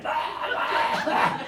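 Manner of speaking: airless choking gasps; mock choking gasps. Kid clotheslined by his dad, making mock choking gasps. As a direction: fake being strangled on the floor. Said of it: "And I'm on the ground choking".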